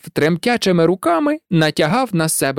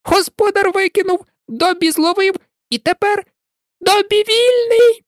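very high squeaky elf voice, building to a joyful cry; jubilant house-elf. A narrator's reading. Dobby proclaiming his freedom to Malfoy, jubilant. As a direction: crow that he is free.

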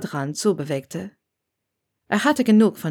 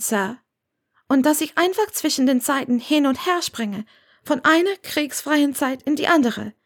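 put-on higher, lively young woman's voice; young witch Hermione, defiant; 6 semitones higher than usual. A narrator's reading. Hermione defends her life to Severus, higher and lively. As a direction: speak up brightly for her as she defends her choice.

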